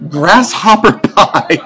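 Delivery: slow, grand title reading; dramatic announcement. Pretend. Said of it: "now for a dramatic reading of".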